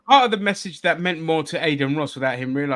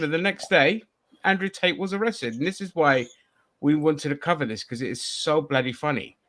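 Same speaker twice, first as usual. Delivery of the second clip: ordinary talk, nothing marked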